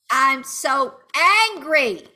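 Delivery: slow, loud, high anger; angry venting. Shown as a demonstration. Coach modelling release, slow loud anger. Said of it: "raise your voice".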